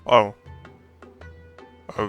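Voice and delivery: ordinary delivery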